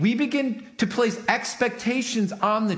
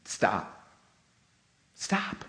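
firm hush; 13 semitones lower, 7 dB quieter than usual. Retold or imitated. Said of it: "I'm like".

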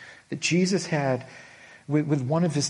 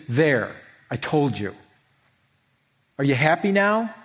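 snide, rising and louder taunt; mocking I-told-you-so; 4 dB louder than usual. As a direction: rub it in, sarcastic. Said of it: "He didn't do that".

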